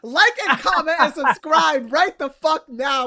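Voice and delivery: loud and high, mock-bossy order to the viewers; loud joking demand. Real, not acted. Host ordering viewers to like and subscribe, loud and mock-bossy. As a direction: bark it at the audience like a playful boss.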